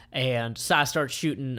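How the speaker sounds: ordinary delivery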